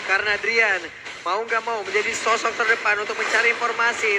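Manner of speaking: ordinary talk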